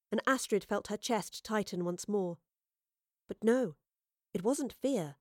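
ordinary delivery, nothing marked

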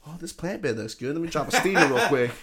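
mock homeless lady. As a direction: chirp it like her.

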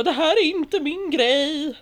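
high, slow frightened plea; scared cry. Retold or imitated. Lifted up the net, high slow scared plea. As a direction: beg to get down, terrified of heights. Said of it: "jag bara".

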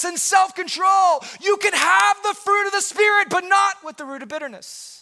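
building from brisk urging to a loud, high climax; full-force preaching. Acted. Pastor rallying the congregation, rising to a loud preaching climax.

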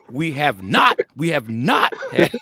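put-on loud indignant kid voice; boy correcting. Oldest grandson correcting the chant, loud and indignant. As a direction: insist on the point like a boy who won't be fooled. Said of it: "Oldest boy said".

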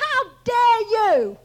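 loud, high "how dare you"; outraged preacher's telling-off; retold indignant rebuke. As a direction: re-enact scolding a friend at full voice. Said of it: "I could talk to him like that".

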